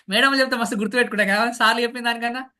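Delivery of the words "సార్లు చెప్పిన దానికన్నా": ordinary talk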